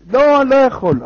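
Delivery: high, loud refusal; exasperated refusal. Acted. Woken man refuses to light the candle, loud exasperation. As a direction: refuse loudly, fed up.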